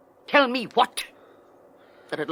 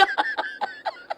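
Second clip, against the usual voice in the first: high mocking cackle; scornful laugh; 7 dB quieter than usual. Henrietta mocking the caught lovers, scornful laugh. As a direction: laugh at their charade.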